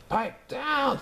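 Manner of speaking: very high scratchy command; witchy order. Acted. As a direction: snap at the class to hush. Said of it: "said a Swamp".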